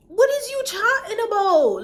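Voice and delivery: high, loud disbelieving "what are you talking about"; incredulous outburst. Retold or imitated. To partner, retelling her outraged loud disbelief. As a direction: blurt it out in shocked disbelief. Shocked reply to partner's claim.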